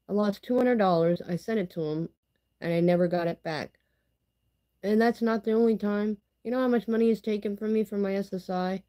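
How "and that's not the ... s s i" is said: normal talking voice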